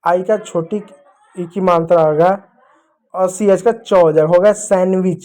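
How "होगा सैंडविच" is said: ordinary talk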